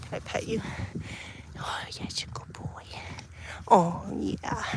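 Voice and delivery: soft unvoiced whisper while petting; whisper to dog. Real, not acted. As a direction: hush your voice to a whisper for the dog.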